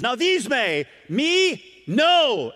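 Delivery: high, loud, insistent; preacher voicing Peter's hard no; emphatic no. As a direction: Peter refusing outright. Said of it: "he even at one point says".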